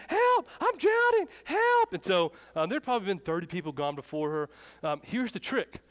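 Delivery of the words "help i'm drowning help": very high, loud drawn-out panicked scream; screaming for help. Retold or imitated. Drowning middle school girl calling for rescue, screaming. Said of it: "yelling, screaming".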